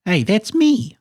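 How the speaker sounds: high, excited little bird voice; small character's delighted outburst; Kiwi. A narrator's reading. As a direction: pipe up high in happy recognition. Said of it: "said Kiwi".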